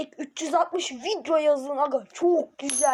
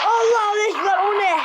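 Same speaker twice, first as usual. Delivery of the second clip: high, loud frightened outburst; scared cry; 7 semitones higher, 5 dB louder than usual. Real, not acted. Jump-scared by an alien on screen, frightened yell. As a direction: yelp at the sudden shock.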